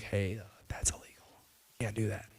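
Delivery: whispered, hushed police warning; a hushed aside from the chief of police; quiet warning. Retold or imitated. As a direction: whisper it like a nervous official.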